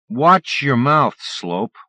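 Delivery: loud, slow, high threat; menacing threat. Acted. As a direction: drag out the warning menacingly. Bully threatens Del.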